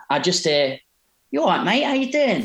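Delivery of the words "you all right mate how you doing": high, imitated matey hello; Russell Brand greeting. Comedian greeting a stranger in a bar, imitated high and chirpy. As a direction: call out a lilting, higher-pitched greeting. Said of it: "and Russell Brand's there".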